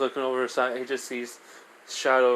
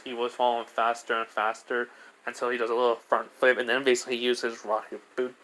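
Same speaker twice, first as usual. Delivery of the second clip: usual speaking voice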